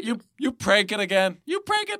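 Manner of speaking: rising high, incredulous mock challenge; mock accusation. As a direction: play it like you're catching a prankster out. Mock accusing prank caller.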